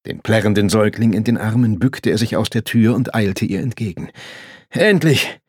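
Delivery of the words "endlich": high, louder cry of greeting; raised, eager shout; called out. A narrator's reading. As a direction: call out as if hailing someone. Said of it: "rief er".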